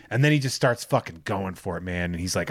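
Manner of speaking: ordinary talk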